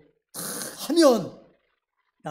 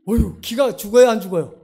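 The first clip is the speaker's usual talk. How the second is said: ordinary delivery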